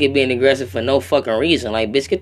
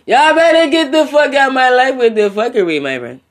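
yelling it out at the haters; loud yell; 8 semitones higher, 5 dB louder than usual. Real, not acted. Vlogger yelling at hypocrites, loud angry shout. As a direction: raise the voice and blast the line.

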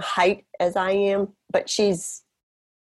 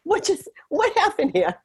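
mock bewildered; 11 semitones higher than usual. Mock bewilderment after being carried out.

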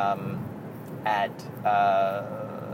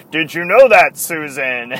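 John Sterling impression; 3 semitones higher, 8 dB louder than usual. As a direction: ham up a self-important old radio man, dragging the words. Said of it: "She'll try and do his voice".

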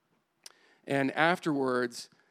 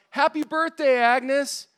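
high, loud birthday shout; group yell; 11 semitones higher, 7 dB louder, slower than usual. Retold or imitated. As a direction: shout it like a room full of people springing a surprise. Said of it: "everyone yells".

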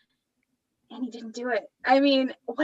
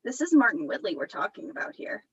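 usual speaking voice

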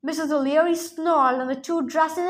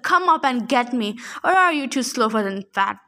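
ordinary delivery

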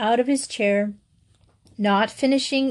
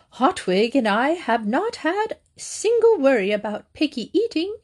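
higher, lilting self-satisfied voice; lightly voiced smug lady on the phone; smug neighbour; 4 semitones higher than usual. A narrator's reading. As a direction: coo it with airy superiority. Mary Jo's smug phone reply.

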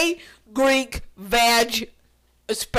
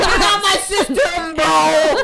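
loud joking shout; playful yell; 5 semitones higher, 5 dB louder than usual. Real, not acted. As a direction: yell it out, laughing. Playful yelling at sister.

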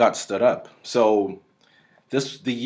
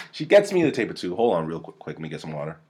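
ordinary talk, nothing marked

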